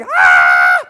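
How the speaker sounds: loud, long acted scream; falling scream. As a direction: scream out in mock terror. Screaming as goggle flaps, falling.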